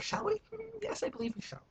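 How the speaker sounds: high put-on voice answering himself; ask, then reply as a haughty stranger; mock posh self-reply. Answering his own question in a high mock voice.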